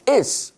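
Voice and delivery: "is" hit high and hard; stress on is. Shown as a demonstration. Preacher stressing "is" to the congregation, hitting it high. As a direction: lift and punch the word "is". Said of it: "David emphasized".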